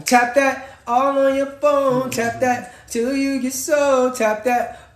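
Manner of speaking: slow, loud mocking taunt. Real, not acted. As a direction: stretch it out to mock them. Taunting chat to keep tapping.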